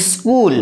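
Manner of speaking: স্কুল with an extra short i before the s; added initial vowel. Shown as a demonstration. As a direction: say স্কুল as "is-kul". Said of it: "একটা অতিরিক্ত হস্যই নিয়ে চলে এলো". (স্কুল-এর আগে বাড়তি হ্রস্ব ই)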